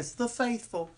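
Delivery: high, hammed-up "The faithful"; southern preacher. Teasing the catchphrase again in a high preacher voice. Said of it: "Every time I hear".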